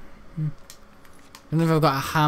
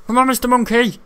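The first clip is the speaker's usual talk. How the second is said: high loud mocking call; teasing taunt; 8 semitones higher, 6 dB louder than usual. Real, not acted. Gamer goading monster, high teasing call. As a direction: goad the monster.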